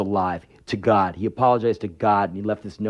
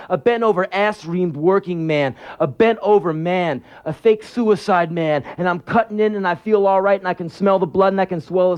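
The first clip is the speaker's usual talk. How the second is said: driving, higher-pitched confessional rant; relentless, incantatory build in a raised pitch; intense spoken-word monologue; 9 semitones higher, 4 dB louder than usual. Acted. Man reliving cutting himself in the bathroom, intense monologue. Intense first-person monologue, rising above plain talk.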